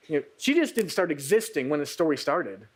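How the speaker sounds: usual speaking voice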